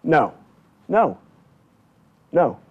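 ordinary talk

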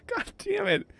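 very high, exasperated groan; exasperated squeal. Real, not acted. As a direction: squeak it out in disbelief. Character swims off again.

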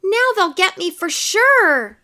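high, loud doomed wail; panicked storybook cry. A narrator's reading. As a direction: lament that the chase is lost. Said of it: "he cried".

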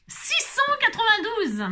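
high, slowed announcement of the number; excited call. Real, not acted. Calls out drawn number.

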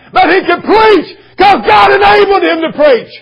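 loud, high shouted preaching; full-force preaching. Acted. Preacher shouting to his congregation, loud and high. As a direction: drive it home to the congregation.

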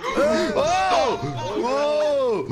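laute, hohe Schreckrufe; erschrockene Ausrufe. Real, not acted. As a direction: vor Schreck aufschreien. Sudden shock in game. (erschrockene Ausrufe)

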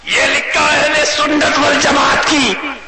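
high, slow, emphatic declaration; indignant shout. Acted. Mosque man asserts whose mosque it is, indignant shout. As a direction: proclaim it angrily, stretched out. Mosque men confront the outsider.

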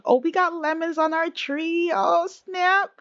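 high, delighted burst; thrilled to spot the lemons; excited discovery. Real, not acted. Finds lemons on her tree.

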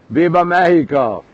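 normal talking voice

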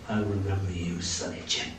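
soft, half-voiced muttered threat. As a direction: grumble it under the breath. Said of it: "scowled at me as if to say".

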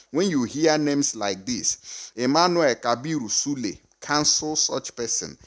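ordinary talk

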